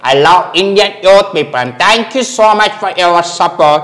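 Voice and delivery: put-on very slow, deliberate accented English; mimicked foreign celebrity. Mimic praises India in very slow English. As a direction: drag out each English word like a visiting star.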